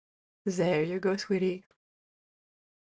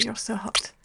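whisper; 4 semitones higher, 3 dB quieter than usual. Acted. Intimate roleplay, close murmur.

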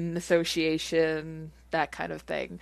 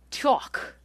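Brooklyn accent; 7 semitones higher than usual. Shown as a demonstration. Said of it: "in a Brooklyn accent".

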